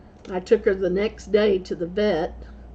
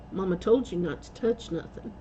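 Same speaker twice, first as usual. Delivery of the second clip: dog's scolding voice; 2 semitones lower, 6 dB quieter than usual. Retold or imitated. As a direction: speak for the dog, quietly scolding the intruder. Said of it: "just hard enough to let him know".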